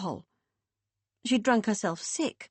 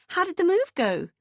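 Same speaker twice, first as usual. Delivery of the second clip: high, louder sing-song sister voice; 11 semitones higher, 5 dB louder than usual. A narrator's reading. Beth chirping down the phone in a high sing-song.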